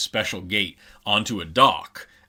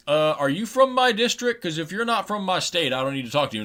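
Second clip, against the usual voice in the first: much higher, snooty mock politician; dismissive senator; 9 semitones higher than usual. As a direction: brush them off in a mocking high voice. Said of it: "he's like".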